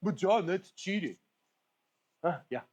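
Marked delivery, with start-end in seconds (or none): 0.0-1.2 drawn-out, higher-pitched mock protest; mock objector. Imagined viewer objects to his warm-up, mock protest. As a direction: imitate a viewer complaining, slow and higher. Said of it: "somebody here is gonna go".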